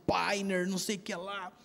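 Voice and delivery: ordinary talk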